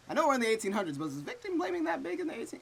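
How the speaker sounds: high, loud incredulous protest; outraged disbelief. Real, not acted. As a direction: voice climbing in outrage. Outraged at victim blaming.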